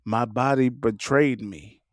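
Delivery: mock melodrama, voice dropped low; hammed-up tragic movie line; Tyler Perry. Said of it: "you be sounding like Tyler Perry".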